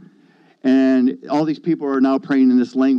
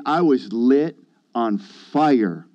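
ordinary talk

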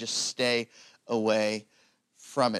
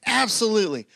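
eager yes; 9 semitones higher, 3 dB louder than usual. Retold or imitated. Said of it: "Peter's like".

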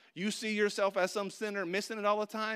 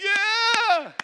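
much higher, louder yell; mock crowd cheer; 13 semitones higher, 12 dB louder than usual. Congregation cheering the shot, a loud yell. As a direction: shout like the whole gym. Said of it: "y'all were like".

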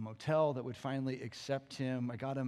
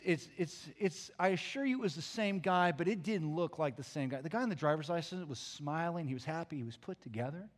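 ordinary talk, nothing marked

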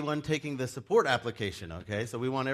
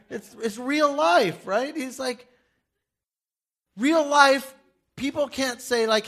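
much higher, louder, slow insistence; preaching emphasis, high and loud; emphatic; 14 semitones higher, 11 dB louder, slower than usual. Real, not acted. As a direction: hammer home that it's real. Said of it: "pastor emphasizing".

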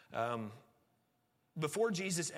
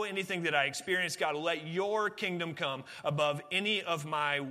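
fervent preaching; 3 semitones higher, 4 dB louder than usual. Acted. Rising prayer, preached louder.

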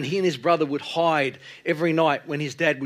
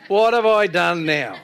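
higher, louder, slow mock-aggrieved wail; hammed-up younger brother; 4 semitones higher, 7 dB louder than usual. Preacher replaying David's retort for laughs, mock-aggrieved. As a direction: exaggerate the little brother's complaint, half laughing. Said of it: "that's the words of a younger brother".

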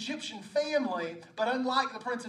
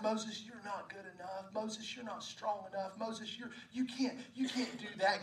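soft, insidious inner voice; whispering insecurity; 7 dB quieter than usual. Acted. Spider of insecurity whispering into Moses' life, hushed. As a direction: plant doubt softly, well under full voice. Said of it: "Whispering in".

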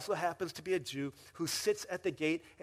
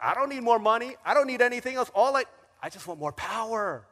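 loud and higher, hungry for power; greedy boast; 8 semitones higher, 12 dB louder, faster than usual. Retold or imitated. Preacher voicing Haman wanting only power, greedy boast. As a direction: crave it out loud. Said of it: "He's like".

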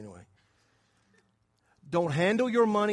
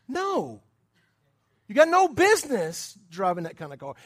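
emphatic rebuke; 2 semitones higher, 5 dB louder than usual. Acted. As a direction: scold an overreaching buyer from the pulpit. Scolding the imagined car buyer.

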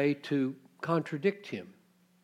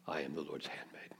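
whispered very soft and low, quicker; humble whisper; 10 semitones lower, 14 dB quieter, faster than usual. Acted. Mary whispers her surrender to the angel. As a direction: breathe it out in lowly surrender. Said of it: "goes on her face and says".

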